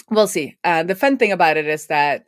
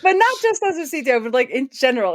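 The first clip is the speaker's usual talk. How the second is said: laughing while talking; 9 semitones higher than usual. Real, not acted. Laughing after CTO joke.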